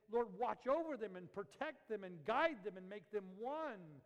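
loud, high, drawn-out plea; fervent prayer. Acted. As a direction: pray it out with preacher's fervor. Acting out fervent prayer for disciples.

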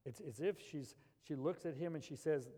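normal talking voice

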